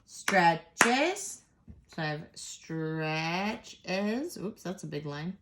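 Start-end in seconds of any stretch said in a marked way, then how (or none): 0.1-1.4 "Stretches" split in two, rising high at the end; "Stretches" in two beats with a questioning lift; syllables counted out. Shown as a demonstration. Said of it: "Let's cough that out". 2.3-4.4 "stretches" very slow in two parts; long, slow "stretches" with a rise at the end; syllables drawn out. Shown as a demonstration. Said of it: "Let's cough that out".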